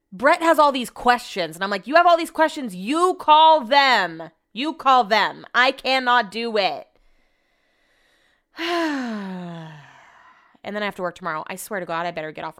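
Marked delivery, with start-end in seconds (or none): 1.9-6.9 slow, emphatic, exasperated refusal; acting out an exasperated telling-off; fed-up retort. Acted fed-up retort to husband. 8.6-9.7 long exhaled sigh; drawn-out fed-up sigh; weary sigh. Real, not acted. Sighs after fed-up retort.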